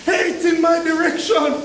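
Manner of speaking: normal talking voice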